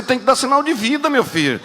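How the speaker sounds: shouting sermon climax; fiery preaching. Real, not acted. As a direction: whip the church up, building. Preaching at full force.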